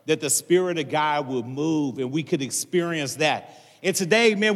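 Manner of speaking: ordinary talk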